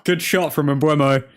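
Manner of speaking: ordinary talk, nothing marked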